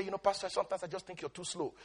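soft, fast retold complaint; quiet quick quote. Fellow pastor teases him, soft and quick. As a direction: toss off the quote softly and quickly. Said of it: "One of our pastors used to say".

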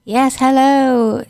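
ordinary talk

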